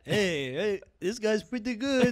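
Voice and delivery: slow, amused mock Romanian accent; put-on Romanian-accented approval. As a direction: approve as a Romanian audience member. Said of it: "I'm the Romanian guy".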